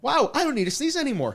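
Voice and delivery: high, fast amazed wow. Real, not acted. Sneeze vanishes, amazed.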